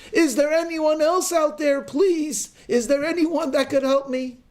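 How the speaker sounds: high, loud call for someone else; panicked shout. Acted. Hiker refusing God's advice, shouting for another rescuer. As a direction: yell past God's answer for anyone else. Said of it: "and then shouts".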